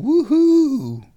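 high loud woohoo; cheering whoop. Retold or imitated. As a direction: let out a whoop. Cheering at the idea.

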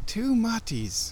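"Two matis" cooed high and slow; sing-song cooing. Shown as a demonstration. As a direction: croon "Two matis" like baby talk. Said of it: "pet name for marmots".

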